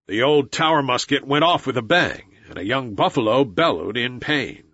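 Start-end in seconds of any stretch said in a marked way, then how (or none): none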